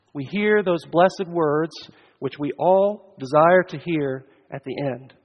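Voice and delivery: ordinary delivery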